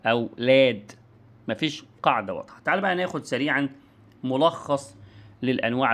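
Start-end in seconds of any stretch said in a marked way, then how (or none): 0.4-0.9 لاد with l first and a long aa; child's لاد, opening w gone; random sound change. Shown as a demonstration. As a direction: say لاد as a child's garbled word. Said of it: "يغير أصوات بدون نمط واضح".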